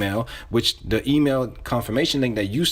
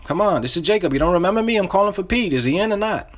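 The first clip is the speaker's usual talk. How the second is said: higher, insistent and familiar; urgent, pitched-up insider; close-friend caller; 7 semitones higher than usual. Shown as a demonstration. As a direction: press them like an old friend being screened. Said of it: "You're calling like your close friend".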